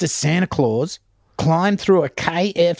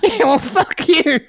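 high-pitched, hammed-up indignation; mock outrage; 9 semitones higher than usual. Santa and sleepless kid swearing at each other, mock outrage. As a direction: squawk it as a comic confrontation. Acts out catching Santa at chimney.

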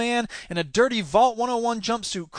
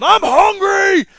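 slow, loud, high monster howl; wailing creature; 12 semitones higher, 9 dB louder, much slower than usual. A narrator's reading. Creature after its meal, drawn-out wailing shout. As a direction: stretch it into a hungry bellow. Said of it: "the creature wailed".

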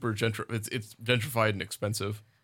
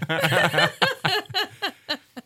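high, loud villain cackle; evil laugh; 5 dB louder than usual. Pretend. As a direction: go over the top with a shrieking evil laugh. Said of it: "Are we gonna do an evil laugh off?".

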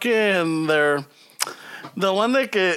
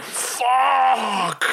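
a shocked, crying-out curse; shocked fuck; 3 semitones higher than usual. Retold or imitated. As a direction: blurt it out in disbelief. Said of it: "I'm like".

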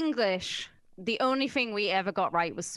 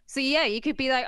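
ordinary delivery

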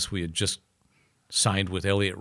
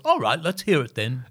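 lightly imitated rock star, pitched up; 5 semitones higher than usual. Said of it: "he sits down and goes".

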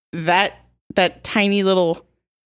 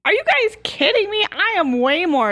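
indignant; 12 semitones higher than usual. Acted. Indignant at being ranked lower.

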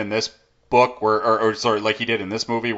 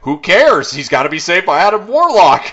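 higher, louder brush-off; dismissive producer; 7 semitones higher, 7 dB louder than usual. Retold or imitated. The producer overruling the writer, mock dismissive. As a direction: wave the objection away loudly. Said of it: "they're like".

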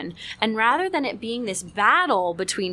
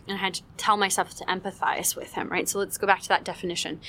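ordinary talk, nothing marked

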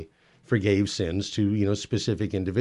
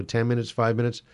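ordinary delivery

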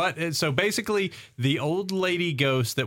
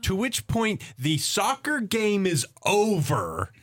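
higher, drawn-out storytelling; dramatic story narration; 6 semitones higher than usual. Acted. Recapping the chase for co-hosts, dramatic narration. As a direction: build up the plot slowly, pitching key phrases high.

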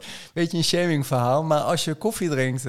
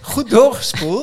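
proud punchline; 10 semitones higher, 8 dB louder, slower than usual. Retold or imitated. Proud punchline of toilet story.

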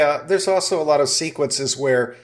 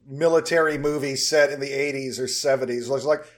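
ordinary talk, nothing marked

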